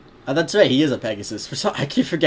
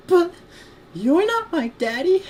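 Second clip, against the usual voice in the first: put-on high, slow little-girl protest; badly faked little filly; 4 dB louder than usual. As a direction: put on a squeaky small-child voice. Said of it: "I can't do a good little girl voice".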